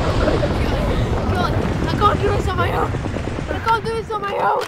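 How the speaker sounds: loud, strained pleading; straining shout. Acted. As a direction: shout while struggling. Struggling to carry partner.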